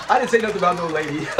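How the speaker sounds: fast, laughing Fred quote; quoting Fred, laughing. Retold or imitated. Host repeating Fred's comeback while laughing. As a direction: rattle it off amused. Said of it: "So simple".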